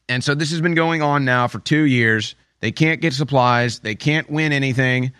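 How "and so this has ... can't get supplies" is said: usual speaking voice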